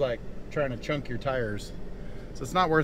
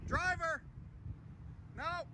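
calling out; 13 dB quieter than usual. Real, not acted. Guiding truck driver from afar.

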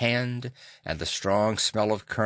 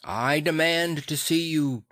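high, raised demanding voice; insistent raised voice; loud demand; 6 semitones higher than usual. A narrator's reading. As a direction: demand it loudly, pitched up. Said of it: "cried Utterson with a loud voice".

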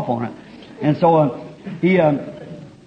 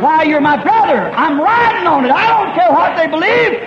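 full-force preaching; 8 semitones higher than usual. Acted. Preacher shouting unity to the congregation. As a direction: preach it out with everything.

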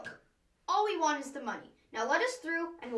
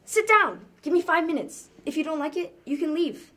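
insistent; 3 semitones higher, 7 dB louder than usual. Acted. Insisting loudly on telling story.

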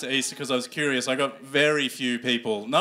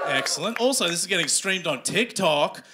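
ordinary talk

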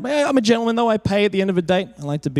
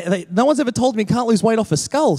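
usual speaking voice

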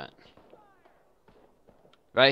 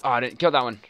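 normal talking voice

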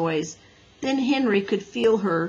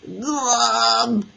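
gurgle; 3 semitones higher, 5 dB louder than usual. A narrator's reading. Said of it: "said Henry".

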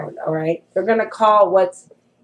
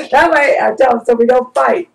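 laughing, higher and louder; 4 semitones higher, 5 dB louder than usual. Real, not acted. Explaining to viewers how she avoids fights, laughing. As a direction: laugh it out in a raised voice. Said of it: "laugh heard".

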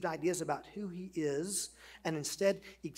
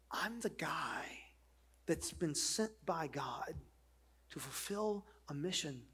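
hushed Jesus; 5 dB quieter than usual. Acted. Preacher voicing Jesus to the crowd, whispered. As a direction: breathe his words as a quiet promise.

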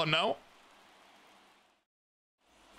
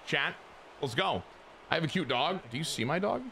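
usual speaking voice

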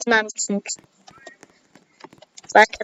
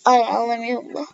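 normal talking voice

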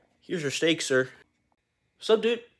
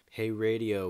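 ordinary talk, nothing marked

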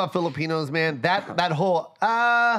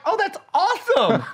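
high, bubbly clueless teacher; dumb teacher voice. Retold or imitated. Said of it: "the dumb teacher was like".

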